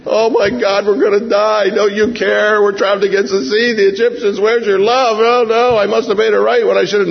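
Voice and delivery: hammed-up panic; panicked cry. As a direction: fret like everything is lost. Preacher mimics panicked us.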